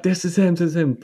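high excited bear voice; mock bear excitement. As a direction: squeal it high, sure you've caught him. Voicing the bears grabbing Puss.

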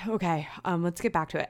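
usual speaking voice

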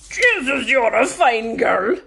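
high, loud, drawn-out Kerry farmer; old Kerry farmer. Retold or imitated. Old farmer complimenting air hostess in the aisle, Kerry accent. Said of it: "pure Kerry old farmers".

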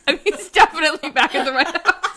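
amused, laughing correction. Real, not acted. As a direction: crack up while stressing the correction. Laughs while correcting the story's guess.

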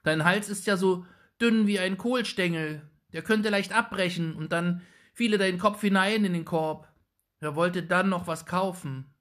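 put-on high, loud, fretful boy's voice; a small boy calling out nervously; anxious boy. A narrator's reading. Little Jakob crying out anxiously at the witch, high and loud. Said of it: "rief der Kleine ängstlich".

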